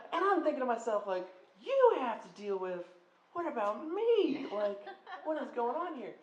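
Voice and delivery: high, loud and indignant; incredulous. Retold or imitated. Student fumes about the dog guy's complaint, high and loud. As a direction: protest in outrage.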